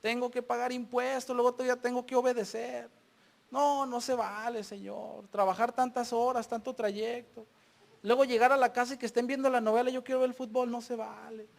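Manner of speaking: soft, droning list of grievances; mock complaining. People who love complaining to the Lord, mock whining. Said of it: "nos encanta quejarnos".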